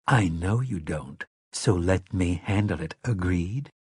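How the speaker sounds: soft, firm reassurance; quiet calming. A narrator's reading. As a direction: hush her gently. Calming anxious Mia inside.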